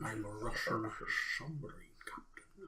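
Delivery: put-on soft, half-whispered Connery accent trailing off; quiet whispered mock Connery; echoing Connery impression. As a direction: murmur the Connery line, fading. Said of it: "repeats Connery line as impression".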